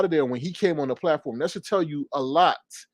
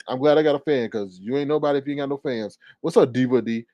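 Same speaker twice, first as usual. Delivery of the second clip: ordinary talk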